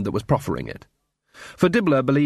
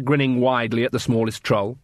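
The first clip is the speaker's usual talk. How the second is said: usual speaking voice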